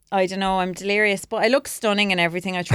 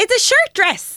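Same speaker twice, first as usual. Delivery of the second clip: indignant; 5 dB louder, slower than usual. Real, not acted. Defending her dress, indignant.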